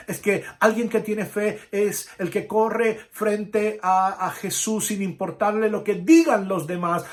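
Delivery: loud, high preaching at full force; fiery preaching. Acted. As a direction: preach it out to the whole church. (predicación enérgica y fuerte)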